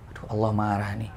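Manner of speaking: worried angel. Acted. Angels fear God's anger.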